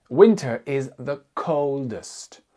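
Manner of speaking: slow, deliberate Winter is the coldest; teacher modelling English slowly; model sentence, stressed. Shown as a demonstration. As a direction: spell it out slowly for learners. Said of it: "On dit que l'hiver est la saison la plus froide".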